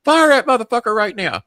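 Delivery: high, loud ranting boss impression; furious. Boss ordering him to fire Mark, furious. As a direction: rage down the phone, building to a loud order. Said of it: "they're fucking furious".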